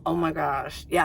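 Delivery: ordinary delivery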